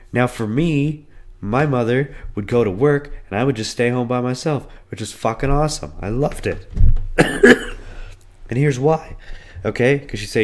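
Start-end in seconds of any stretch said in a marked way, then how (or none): none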